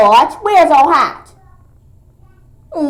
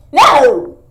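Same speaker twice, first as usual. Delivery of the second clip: 1 loud refusal; 5 semitones higher, 9 dB louder than usual. Acted. Child refusing the doctor.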